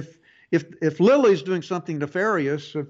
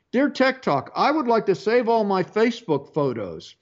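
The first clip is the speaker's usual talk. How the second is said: brighter letter-reading voice, a bit higher; 4 semitones higher than usual. Acted. Host reads a listener's email to the audience, letter-reading voice. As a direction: read the listener's email out on air.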